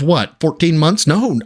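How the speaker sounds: usual speaking voice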